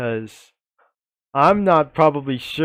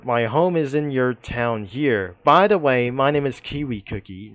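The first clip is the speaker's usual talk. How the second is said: ordinary talk, nothing marked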